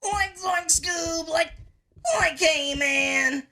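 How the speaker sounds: high cracking stoner voice; Shaggy voice. Retold or imitated. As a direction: crack it up high like the cartoon slacker. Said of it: "trying out the Shaggy voice".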